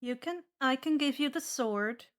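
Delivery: ordinary delivery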